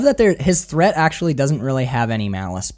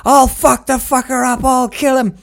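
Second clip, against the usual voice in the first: higher, louder ranting yell; mock raging scream; 10 semitones higher, 5 dB louder than usual. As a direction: scream threats once safely away. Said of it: "Withnail screams and shouts".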